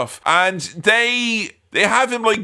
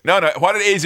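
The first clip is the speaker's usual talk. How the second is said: usual speaking voice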